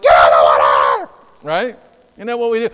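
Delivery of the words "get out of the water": loud, rough all-out scream; panicked yell. Acted. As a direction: scream everyone out of danger. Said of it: "No, you'd be like".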